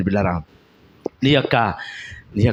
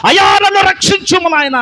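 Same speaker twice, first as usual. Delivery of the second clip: higher, louder cry for rescue; sinking Peter crying out to the Lord; desperate cry; 8 dB louder than usual. Acted. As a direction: shout for help as you sink. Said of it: "వెంటనే అరుస్తున్నాడంట".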